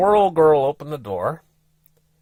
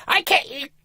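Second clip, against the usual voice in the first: lightly voiced frustrated wife; a hint of pitched-up frustration; voicing the wife's upset, pitch raised; 13 semitones higher than usual. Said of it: "She started getting frustrated".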